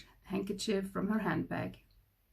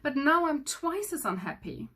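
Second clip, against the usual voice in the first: wailing; 7 semitones higher, 4 dB louder than usual. A narrator's reading. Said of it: "he wailed".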